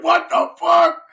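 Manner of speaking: high, loud shocked wail; shocked outcry. Retold or imitated. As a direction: cry out in disbelief at the price. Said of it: "I'm gonna be like".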